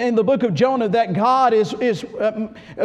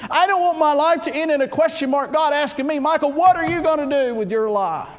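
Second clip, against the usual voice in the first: fiery preaching; 7 semitones higher, 3 dB louder than usual. Acted. Preaching at full force.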